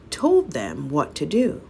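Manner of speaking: usual speaking voice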